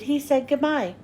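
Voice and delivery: ordinary delivery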